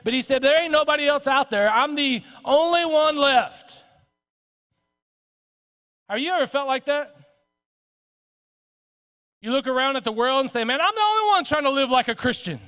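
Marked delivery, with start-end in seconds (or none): 0.4-3.8 high, aggrieved wail of complaint, slowing at the end; Elijah. Acted. Elijah protesting to God that he stands alone, indignant. 10.6-12.6 mildly hammed-up high-pitched grievance; self-pitying complaint. Believer looking around at the world, complaining in mock indignation.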